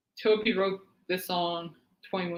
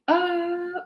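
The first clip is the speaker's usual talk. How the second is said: a sudden high cry that copies the singer's release of grief; a quick, much higher "uh", like the moment in the song; imitated vocal cry; 11 semitones higher than usual. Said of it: "then there's in the song where he goes like".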